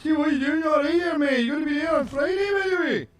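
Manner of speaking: high, shouted Glaswegian blur of vowels; loud, very high-pitched slurred Glaswegian caricature; Glaswegian impression. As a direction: wail it in mock Glaswegian, consonants swallowed. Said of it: "where Glaswegian's like".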